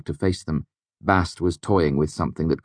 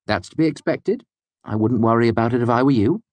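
higher, friendly fey voice; a higher, breezy voice; lightly voiced Bast; 6 semitones higher, 4 dB louder than usual. A narrator's reading. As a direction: pitch it up, easy and friendly. Bast's voice.

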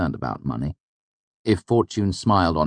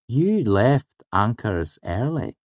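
slow, higher, deliberate accusation; slowly; 7 semitones higher, slower than usual. A narrator's reading. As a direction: lay it out one word at a time. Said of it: "Will said slowly".

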